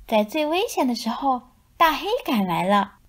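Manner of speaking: usual speaking voice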